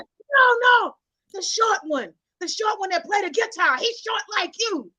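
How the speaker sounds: high, insistent grandma explaining; grandmother's voice. Retold or imitated. Grandmother describing the musician, imitated impatience. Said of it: "but do the voices".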